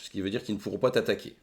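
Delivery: ordinary talk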